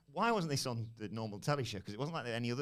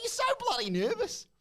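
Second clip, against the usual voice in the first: much higher and louder, teasing; high taunting voice; 5 dB louder than usual. Retold or imitated. Champion taunting the nervous interviewer, a high loud tease. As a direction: needle her like a schoolyard bully.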